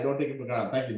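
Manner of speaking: ordinary talk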